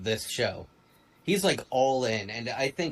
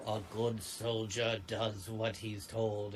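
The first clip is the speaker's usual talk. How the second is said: slow, quieter recital of a creed; obedient soldier; 6 dB quieter, slower than usual. Retold or imitated. Mocking how all in on the Empire he is, slow soldierly recital. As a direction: lightly imitate a soldier reciting doctrine.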